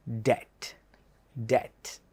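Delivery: ഡെറ്റ് said softly as det, last one whispered; det, silent b, fading to a whisper; soft correct debt. Shown as a demonstration. Teacher quietly repeats the right debt. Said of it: "correct pronunciation".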